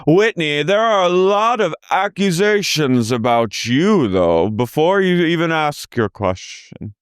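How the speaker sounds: slow, high parody of a castmate; imitating Meredith. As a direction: lay out the accusation slowly and primly. Mimicking Meredith.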